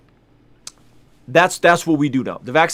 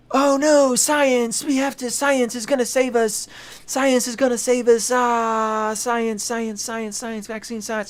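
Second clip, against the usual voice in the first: much higher, fading to a near mumble; mock science believer; 10 semitones higher, slower than usual. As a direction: parody a starry-eyed science fan, trailing off. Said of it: "others are like".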